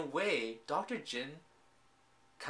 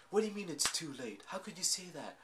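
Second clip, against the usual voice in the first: put-on higher, fast, affronted young woman; indignant girl; 5 semitones higher, 4 dB quieter, faster than usual. Retelling the heroine turning on the doctor, higher and indignant. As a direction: throw his words back at him in disbelief.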